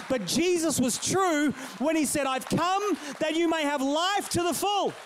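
slow, emphatic preaching. Acted. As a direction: drive it home to the congregation slowly. Climax of the sermon.

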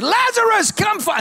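ringing loud call, pitched high; loud command. Retold or imitated. Jesus calling Lazarus from tomb.

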